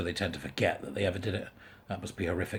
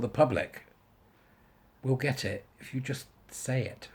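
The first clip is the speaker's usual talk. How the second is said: ordinary talk, nothing marked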